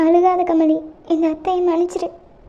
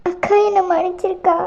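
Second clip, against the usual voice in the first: usual speaking voice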